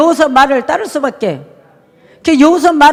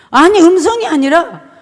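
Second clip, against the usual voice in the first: usual speaking voice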